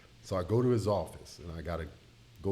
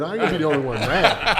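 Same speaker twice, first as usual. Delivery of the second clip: laughing; 6 semitones higher, 9 dB louder than usual. Real, not acted. Recalling his angry outburst.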